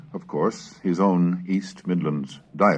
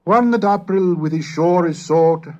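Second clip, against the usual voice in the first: higher, louder reading of Middle English verse; Chaucer recitation; 12 semitones higher, 7 dB louder than usual. Acted. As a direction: give the opening lines like a bard starting his tale.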